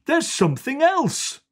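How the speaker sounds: put-on boy spotting something in the hedge; excited child. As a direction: point it out with excitement. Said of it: "says Sam".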